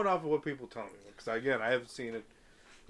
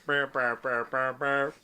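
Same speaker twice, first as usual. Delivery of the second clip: mock rap ad-lib; 5 dB louder, slower than usual. Imitating ad-lib sound.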